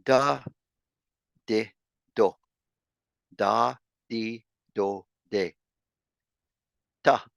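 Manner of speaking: quick "da, di, do, de" style drill; syllable drill. Shown as a demonstration. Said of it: "let's do this a little faster now".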